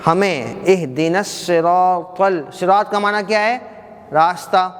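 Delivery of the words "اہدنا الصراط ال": slow melodic recitation; Quranic recitation. Shown as a demonstration. Teacher reciting the verse to the class, slow melodic chant.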